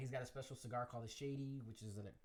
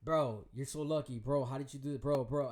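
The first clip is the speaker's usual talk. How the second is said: louder impressed friends; 8 dB louder than usual. Retold or imitated. Friends telling him he is lucky, louder awe. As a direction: lean on each bro, impressed. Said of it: "they'll be like".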